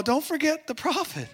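high, laughing tease; laughing reminder. Retold or imitated. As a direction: joke while laughing. Joking reminder.

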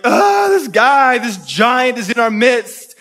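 loud, high, drawn-out groan of alarm; hammed-up dread; mock dismay. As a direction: imitate a panicked crowd, slow and loud. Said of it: "the Israelites are like".

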